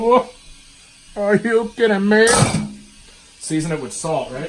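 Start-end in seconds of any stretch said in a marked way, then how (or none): 0.0-2.3 higher, louder mock disbelief; mock outrage. Host feigning shock at the salt step. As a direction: act shocked and offended.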